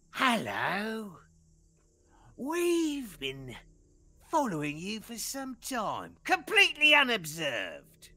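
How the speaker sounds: high, smug goblin leader voice. Acted. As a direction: greet them with sly menace.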